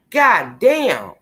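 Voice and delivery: ordinary delivery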